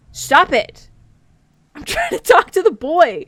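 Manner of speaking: a laughing yell to get them off you; loud laughing protest. Real, not acted. Shouting at a pushy character while laughing. Shooing someone off to talk.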